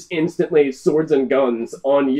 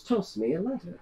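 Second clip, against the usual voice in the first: quiet repeated order; 10 dB quieter than usual. Acted. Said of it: "She goes".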